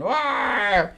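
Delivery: half-hearted high roar; mock monster roar. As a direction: imitate a creature's cry, thinly. Imitating the monster in the comic.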